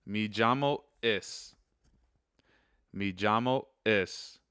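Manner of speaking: slow, careful Spanish pronunciation; Spanish phrase demo. Shown as a demonstration. Teacher slowly models the phrase for students, careful and clear. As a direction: spell out each word slowly for learners. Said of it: "first one is".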